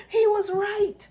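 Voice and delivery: high, loud burst of delight; excited exclamation. Retold or imitated. Recalling a first no-dig potato harvest, high excited cry. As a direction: blurt it out like a thrilled discovery. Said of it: "I was just so excited".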